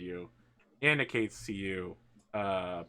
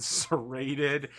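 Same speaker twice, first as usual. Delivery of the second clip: ordinary talk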